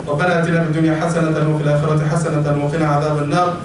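low, fast, level closing prayer; Arabic supplication. Real, not acted. Preacher ending the sermon, low rapid closing supplication.

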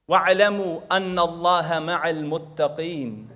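rapid recited Arabic verse, run together; Quran recitation. Acted. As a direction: rattle off the verse in one breath.

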